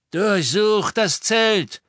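high, loud, drawn-out yell; barked command. A narrator's reading. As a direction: shout the order across the camp. Captor orders tent searched.

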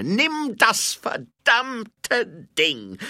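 high, loud, slowly hammered words; furious shout. A narrator's reading. Baroness surrendering the stone under threat, furious shout. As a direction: shout it out, bitterly giving in. Seraphine angrily gives up the stone.

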